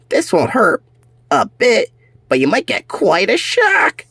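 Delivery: high, sly, gloating threat; villain cat voice. Acted. Cyber cat warning the strapped pets, high and gloating. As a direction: taunt them like a cartoon villain.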